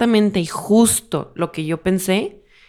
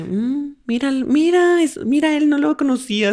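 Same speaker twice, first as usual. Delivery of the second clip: higher, surprised inner voice; retelling first sight of him, higher surprised voice; mock surprise; 7 semitones higher than usual. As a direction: act like you just noticed him.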